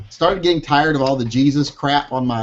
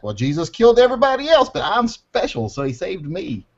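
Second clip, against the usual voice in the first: much higher, smug mocking voice; smug believer; 7 semitones higher than usual. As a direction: parody a self-important believer. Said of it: "a statement like that".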